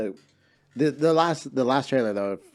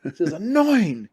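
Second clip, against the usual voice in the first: annoyed whine; 8 semitones higher, 6 dB louder, slower than usual. Real, not acted. Complaining about the trailer.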